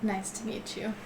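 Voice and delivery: soft in-character line. Acted. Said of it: "I say".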